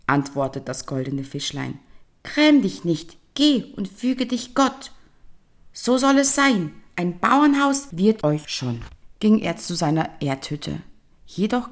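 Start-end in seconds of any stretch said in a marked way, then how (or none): 2.2-8.8 fish voice. Acted. Fish comforting the old man.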